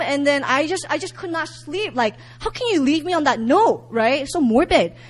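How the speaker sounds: normal talking voice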